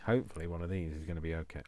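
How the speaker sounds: normal talking voice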